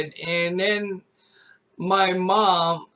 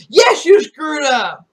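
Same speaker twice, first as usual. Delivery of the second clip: much higher, louder, slower angry shout; retold angry reply; 9 semitones higher, 10 dB louder, slower than usual. Son blames mom for ruining his stream, angry shout. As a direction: blame her hard. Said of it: "I'm like".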